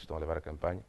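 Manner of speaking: usual speaking voice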